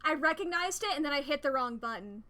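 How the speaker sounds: loud, high exasperated groan; frustrated outburst. Real, not acted. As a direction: blow up at the mistake. Hit wrong button, frustrated.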